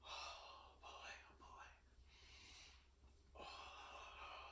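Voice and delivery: whispered, almost breath; soft unvoiced sighs of relief. Real, not acted. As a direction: let it out on a whisper. Breathing during energy release.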